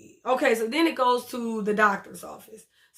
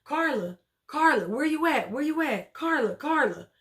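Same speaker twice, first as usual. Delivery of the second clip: higher, singsong mock calling; imitated calling out; 4 semitones higher than usual. Daughter calling the missing caregiver, imitated calling out. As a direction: call for someone missing, without really shouting. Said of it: "So she's calling Carla".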